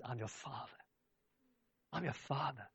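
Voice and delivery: put-on soft, hushed Father speaking to his child; tender voice of God. Preacher voicing God tenderly to his children. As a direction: gently reassure like a loving father.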